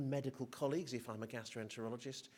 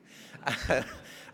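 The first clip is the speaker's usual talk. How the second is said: breathy laugh; 9 dB louder than usual. Real, not acted. Laughing at her own story.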